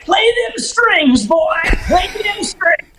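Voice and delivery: high, slow mock drawl; drawling old client. Client urging the captain to work the lines, high slow drawl. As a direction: egg them on like a rowdy old fisherman. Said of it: "bearded guy would be like".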